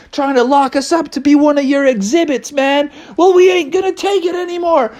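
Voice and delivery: high, indignant confrontation; Bubby. Acted. Bear confronts the zookeeper inside the truck, high strained defiance. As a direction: declaim defiantly, rising at the end. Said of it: "Bubby was like".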